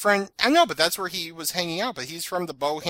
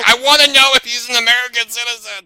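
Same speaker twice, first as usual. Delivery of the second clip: loud, high mock outrage; mock mad; 6 semitones higher, 9 dB louder than usual. Teasing co-host by imitating their demand, mock mad. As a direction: imitate an indignant demand at full volume. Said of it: "I'm still mad. You're like".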